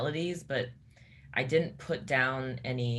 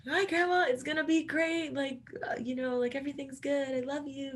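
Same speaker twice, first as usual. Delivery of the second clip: much higher, bright brave front to hide crying; squeaky high reassurance, fighting tears; forced cheerful through tears; 13 semitones higher than usual. Retold or imitated. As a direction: sound upbeat for grandma while holding back tears. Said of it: "trying not to cry and being like".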